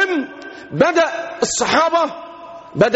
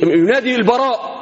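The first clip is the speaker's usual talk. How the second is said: normal talking voice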